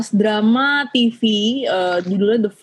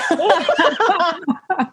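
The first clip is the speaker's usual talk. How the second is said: embarrassed laugh; 7 semitones higher than usual. Real, not acted. Laughing at her own speech.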